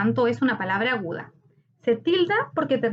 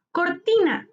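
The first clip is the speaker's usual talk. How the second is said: Cortina with TI raised high, then falling; stress on TI; 6 semitones higher than usual. Shown as a demonstration. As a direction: hit the TI in Cortina. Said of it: "Su sílaba tónica está en ti". (Cortina con acento marcado en TI)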